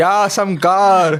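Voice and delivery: drawn-out hammed-up God voice; slow, loud mock God. Friend echoes the ghost's line as a slow booming fake God. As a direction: stretch it out like a booming fake deity.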